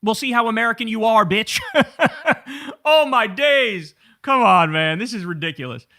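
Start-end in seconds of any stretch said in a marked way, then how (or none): 1.6-2.8 a bright, high burst of laughter. Real, not acted. After mocking Crowder's Canadian accent, laughing high. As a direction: laugh at your own joke.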